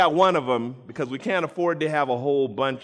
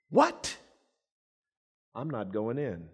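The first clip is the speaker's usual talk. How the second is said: pouting refusal; 3 semitones lower, 5 dB quieter than usual. Acted. Elder son refuses his father, sulking and pouting. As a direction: pout like a jealous older brother. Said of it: "was angry and began to pout".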